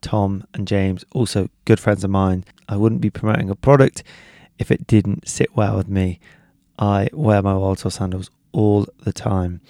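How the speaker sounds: ordinary talk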